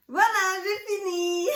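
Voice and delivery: high, loud happy cheer; triumphant high-pitched squeal; gleeful announcement. Real, not acted. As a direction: celebrate being done, voice up and loud. Celebrates finishing the long haul video.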